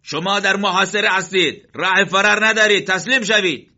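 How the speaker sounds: high, loud shouted demand to surrender; enemy shouting. Acted. Enemy fighter shouting at the besieged men to surrender. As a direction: bellow it across the rocks. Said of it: "شروع کرد به فریاد زدن".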